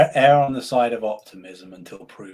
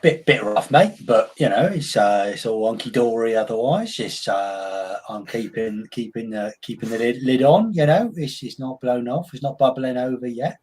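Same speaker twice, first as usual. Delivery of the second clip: put-on Cockney, chatty and easy; Cockney shop owner answering a mate; mock Cockney accent. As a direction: play a London shopkeeper in Cockney. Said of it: "a London accent or a Cockney accent".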